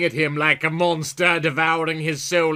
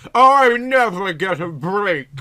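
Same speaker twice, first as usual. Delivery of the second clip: slow, loud, high mock complaint rather than hiss; 7 semitones higher, 9 dB louder, slower than usual. Callie complains, loud and high, hammed up. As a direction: whine it out hammily. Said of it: "she hissed".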